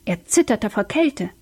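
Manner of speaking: usual speaking voice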